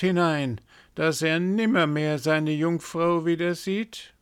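a raven gloating over the coming doom; high raven prophecy. A narrator's reading. First raven to the others, high ominous prophecy.

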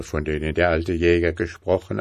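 ordinary delivery, nothing marked

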